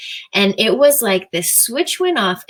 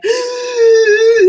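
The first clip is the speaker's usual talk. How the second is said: sharp loud gasp of frustration; high, loud gasp of a frazzled mom; exasperated gasp; 14 semitones higher, 7 dB louder than usual. Retold or imitated. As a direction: gasp like you're at the end of your rope. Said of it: "would make me be like".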